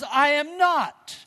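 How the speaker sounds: high, loud, drawn-out denial; emphatic denial. Acted. Peter denying the little girl, loud slow protest. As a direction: stretch each word out in a loud protest.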